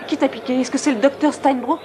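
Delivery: loud anxious questioning; alarmed. Real, not acted. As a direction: press hard for who hurt her. Alarmed, demanding who did it.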